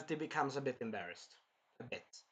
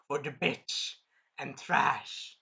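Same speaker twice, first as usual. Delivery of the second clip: normal talking voice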